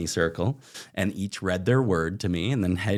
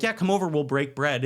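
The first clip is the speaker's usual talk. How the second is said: higher, sing-song welcome; imitating overly friendly hosts inviting him; cheery hosts; 7 semitones higher than usual. Narrator voicing eager hosts, bright and high.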